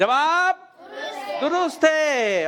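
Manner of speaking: high call, long drawn-out verdict; quiz show announcer. Real, not acted. Host confirming a child's answer, announcer style. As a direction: stretch it out to announce a winner.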